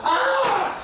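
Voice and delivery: very high acted "aah"; exasperated yell. Foreman failing to convince the bear, exasperated yell. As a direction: let out a frustrated scream.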